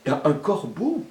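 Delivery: ordinary delivery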